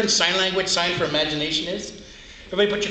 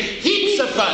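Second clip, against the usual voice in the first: call-and-response; 9 semitones higher, slower than usual. Shown as a demonstration. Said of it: "Everybody say".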